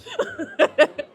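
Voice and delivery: high bubbly giggle; breathy high laugh. Real, not acted. Laughing after her quote.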